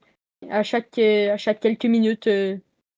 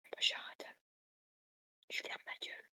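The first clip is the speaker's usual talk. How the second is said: whisper; 20 dB quieter than usual. Real, not acted. Starting to whisper.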